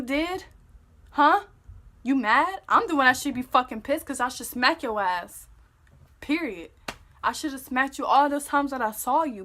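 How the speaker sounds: loud, indignant retelling; re-enacted scolding of a boyfriend; angry confrontation. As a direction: challenge him, hard and loud.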